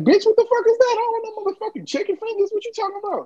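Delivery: put-on high, indignant kid voice; kid outraged. Storyteller voicing his young self refusing chicken fingers. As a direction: squeal it like a confused little kid. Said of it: "I'm like".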